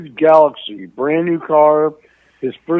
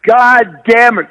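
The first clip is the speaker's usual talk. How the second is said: slow, drawn-out, much louder curse; mock yell from outside; 5 semitones higher, 8 dB louder, much slower than usual. Dad shouting from outside the car, slow mock yell. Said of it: "from outside the car".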